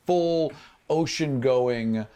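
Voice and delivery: usual speaking voice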